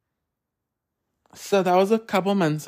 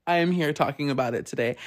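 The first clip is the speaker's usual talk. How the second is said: usual speaking voice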